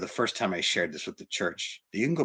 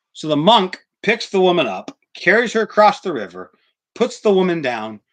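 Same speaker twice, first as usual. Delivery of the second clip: emphatic storytelling; 7 semitones higher, 8 dB louder than usual. A narrator's reading. Sermon climax storytelling.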